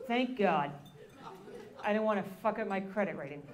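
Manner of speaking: a hint of an old man calmly explaining; impression of grandfather. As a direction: grandson posing as his dead grandfather on the phone, mild old man voice. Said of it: "That was a dead-on impression of my grandfather".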